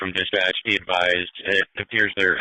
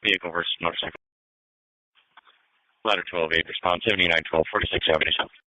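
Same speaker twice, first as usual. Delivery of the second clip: police radio; 6 semitones lower, 4 dB louder, faster than usual. Real, not acted. Unit heard over radio.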